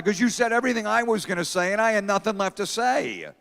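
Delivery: high-pitched imitated child's whine; crying kid. Son accusing his dad of taking his material, mock crying. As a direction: protest like an upset little boy. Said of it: "and he's crying".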